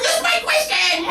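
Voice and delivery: very high, drawn-out fan squeal; imitated excited screaming. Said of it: "her friends are screaming".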